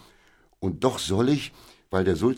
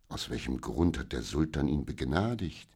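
ordinary delivery, nothing marked

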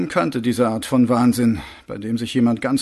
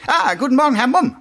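much higher, louder hasty greeting; caught off guard; 14 semitones higher, 7 dB louder, faster than usual. Acted. Sergeant suddenly greeting the boss he was discussing, flustered. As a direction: break off into a high, startled hello.

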